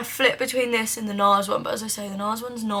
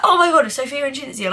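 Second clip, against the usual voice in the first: high, loud retold amazement; starstruck reenactment; 4 semitones higher, 7 dB louder than usual. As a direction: replay the thrilled gasp of recognition, loudly. Retells own starstruck reaction.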